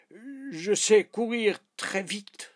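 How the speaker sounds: high, loud, drawn-out boast; boastful exclaiming. Acted. King boasts to the squirrel.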